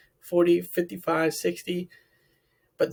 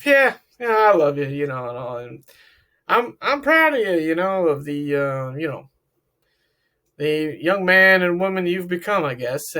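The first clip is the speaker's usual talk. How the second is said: louder, offhand and dismissive, not meant; half-hearted; 4 dB louder than usual. Shown as a demonstration. Dad saying he is proud without meaning it, offhand and louder. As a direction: toss it off like it costs you nothing. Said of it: "What if I said it like this?".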